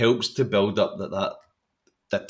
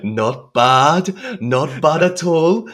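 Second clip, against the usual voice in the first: Spike impression; 7 semitones higher, 7 dB louder, much slower than usual. Continuing the Spike scene impression.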